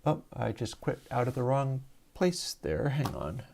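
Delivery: ordinary talk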